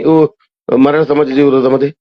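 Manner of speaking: usual speaking voice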